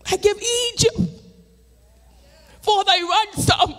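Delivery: full-force preaching. Acted. Preacher testifying to the congregation, full-force preaching. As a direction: preach it out to the saints.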